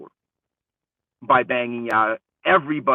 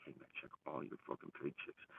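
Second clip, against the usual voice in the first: unvoiced whisper, much softer; whispered tip; 10 semitones lower, 28 dB quieter than usual. Whispering to coworkers about checking their paychecks, secretive. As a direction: lean in and breathe it into a coworker's ear. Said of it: "getting in people's ears like".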